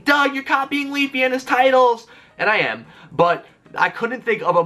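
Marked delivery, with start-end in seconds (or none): 0.0-2.1 high, slow mocking voice of a viewer. Viewers teasing Doug about copying titles, mock high voice. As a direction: pitch it up and tease, slowly. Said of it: "you guys might be thinking".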